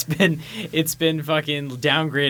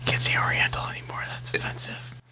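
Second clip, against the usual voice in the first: hushed, whispered correction; 3 dB quieter than usual. Real, not acted. Co-host quietly correcting a friend's wording, whispering. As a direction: whisper it like a discreet warning.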